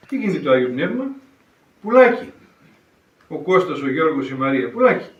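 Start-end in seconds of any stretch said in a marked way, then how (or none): 1.8-2.3 high, loud burst of delight; joyful exclamation. Acted. Priest tells the congregation the Spirit-filled man flies, with a high, loud joyful cry. 4.7-5.1 high, loud burst of delight; joyful exclamation. Acted. Priest tells the congregation the Spirit-filled man flies, with a high, loud joyful cry.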